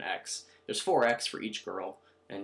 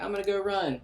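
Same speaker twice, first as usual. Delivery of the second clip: much higher, chipper voice; mock cheerful voice; 10 semitones higher than usual. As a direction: say it brightly like a cartoon. Voicing player in retold scene.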